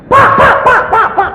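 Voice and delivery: very high, loud exclamations; mock hospital panic; acted startled shouting. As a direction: cry out as if a patient just sat up. Startled nurse and revived patient.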